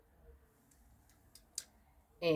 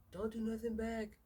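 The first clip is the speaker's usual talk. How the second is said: higher-pitched mock scolding; imitating boyfriend's friend; 6 semitones higher than usual. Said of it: "That's what his friend said".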